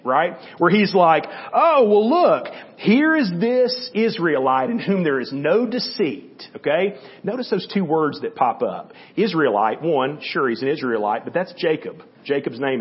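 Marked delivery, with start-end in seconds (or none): 1.5-6.5 higher, louder "Oh, well", then slow and dry; a knowing tease, slow and drawn out; mock-impressed sarcasm, higher pitch and louder at the start; sarcastic. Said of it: "it's just got a little sarcasm in it".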